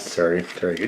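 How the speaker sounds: ordinary talk, nothing marked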